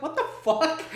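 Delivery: high, loud laughing what-the-fuck; laughing disbelief. Retold or imitated. Friend reacting to the movie, laughing disbelief. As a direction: crack up in disbelief. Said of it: "I'm like".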